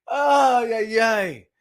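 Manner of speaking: ordinary talk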